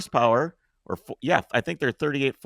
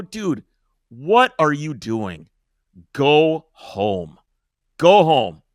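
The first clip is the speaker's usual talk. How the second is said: louder and louder: go home; firm loud command; 3 semitones higher, 6 dB louder, much slower than usual. Retold or imitated. Man ordering the attacker home, loud firm command. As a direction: order him off, harder each time. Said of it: "I go".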